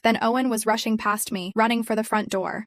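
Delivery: ordinary delivery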